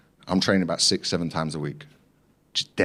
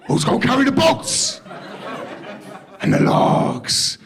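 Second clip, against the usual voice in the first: shouting; slower than usual. Retold or imitated. Imitating Goggins lifting weights.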